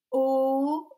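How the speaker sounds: O held long and loud; drawn-out vowel. Shown as a demonstration. Teacher models a sound for children, O held long. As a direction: stretch O out as one long vowel. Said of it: "ça fait".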